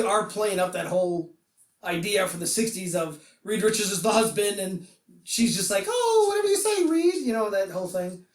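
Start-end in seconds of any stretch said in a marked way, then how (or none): 5.9-7.1 very high, put-on wifely voice; high submissive wife. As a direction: imitate a wife meekly giving in. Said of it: "my voice gets high".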